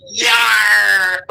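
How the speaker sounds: laughing pirate shout; pirate yar. Retold or imitated. Mocking the pirate friends, laughing pirate shout.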